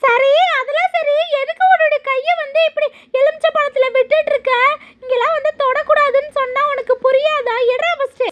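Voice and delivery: high scolding spirit voice, partly fast; scolding ghost. Acted.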